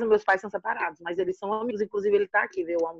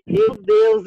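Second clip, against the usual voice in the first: a high, loud burst of alarm; reliving the fright, much higher and louder; shocked gasp; 10 semitones higher, 7 dB louder than usual. Retold or imitated. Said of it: "eu tomei um susto quando ela me falou".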